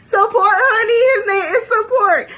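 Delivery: high, loud, laughing cry of the name; laughing shout. Real, not acted. Friend cracking up at the name Support, laughing shout. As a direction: squeal it out through laughter. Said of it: "I was dying laughing".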